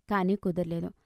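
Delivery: plain reading voice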